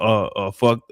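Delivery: normal talking voice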